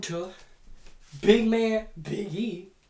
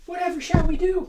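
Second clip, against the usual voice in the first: mock lament; 11 semitones higher, 4 dB louder, faster than usual. Mock lament after elimination.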